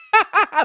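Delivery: high, loud burst of laughter; laughing at your own panic; real laugh. Real, not acted. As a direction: crack up mid-story. Laughs at slamming the door.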